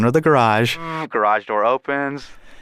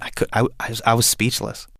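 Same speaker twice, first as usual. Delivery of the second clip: usual speaking voice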